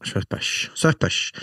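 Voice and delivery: breathy whisper of "Swift push"; hushed amused repeat. Real, not acted. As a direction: savour the joke under breath. Savouring the pub joke.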